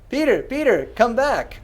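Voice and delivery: high calling out across the room. Real, not acted. Calling someone back to stream.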